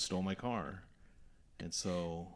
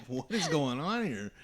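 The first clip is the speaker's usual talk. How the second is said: baffled; 9 semitones higher, 9 dB louder, slower than usual. Retold or imitated. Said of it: "I'm like".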